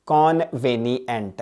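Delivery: "कॉन्वेनिएंट" slowly as con, veni, ent; split for memorising. Shown as a demonstration. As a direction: break "कॉन्वेनिएंट" into three spelt-out parts. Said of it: "कैसे याद रखना है इसको".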